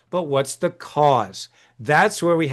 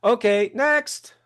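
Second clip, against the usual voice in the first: brusque dentist; 5 semitones higher than usual. Retold or imitated. Mocking a dentist who dismisses his patient, brusque. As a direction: toss it off like a bored dentist, slowing on next. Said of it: "he goes".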